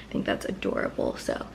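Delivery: soft breathy aside to camera; hushed aside. Real, not acted. Vlogger whispering to her viewers. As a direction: drop to a near whisper.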